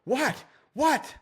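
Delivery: high-pitched mock-indignant retort; super defensive. Client challenged by the trainer, high defensive retort. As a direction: fire back like an offended client. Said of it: "she gets super defensive".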